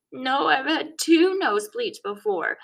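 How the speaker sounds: put-on a hurt five-year-old insisting, near tears; upset little kid. A narrator's reading. Kid correcting through tears.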